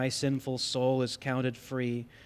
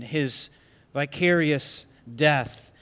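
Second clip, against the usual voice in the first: ordinary delivery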